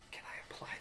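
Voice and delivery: whispering. Real, not acted. Whispering question at table.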